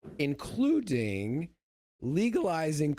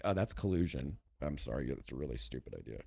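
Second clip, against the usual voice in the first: meek quiet aside; 13 semitones lower, 8 dB quieter, faster than usual. Retold or imitated.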